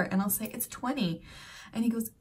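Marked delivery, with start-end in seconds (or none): 0.5-1.2 twenty said American, soft t, /twɛni/; plain American twenty; American accent. Retold or imitated. Mom telling son the number, American. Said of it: "American English ... I'm gonna say twenty".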